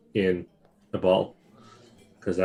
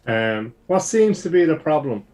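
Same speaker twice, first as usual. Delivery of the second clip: higher, louder inquiring call; 8 semitones higher, 7 dB louder than usual. Acted. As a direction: hail the guard from across the street. Character calls out approaching guard.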